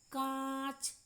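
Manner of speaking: कांच with the nasalised aa held long; drawn-out nasal aa. Shown as a demonstration. Teacher repeating the example, long nasal aa in कांच. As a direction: take time over the vowel in कांच. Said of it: "बोलने में समय भी लगता है".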